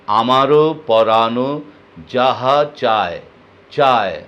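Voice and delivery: "আমারও পরাণ যাহা চায়" spoken slowly, "চায়" clearly ending on ae; careful demo. Shown as a demonstration. Teacher re-says the line slowly to show চায়. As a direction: spell out "চায়" slowly and precisely. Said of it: "আর চায়, third person".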